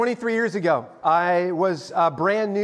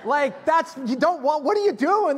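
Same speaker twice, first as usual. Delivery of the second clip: mock-exasperated, higher and pushing; 9 semitones higher than usual. Beginner to brown belt, high mock exasperation. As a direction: scold him in mock disbelief. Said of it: "I was like".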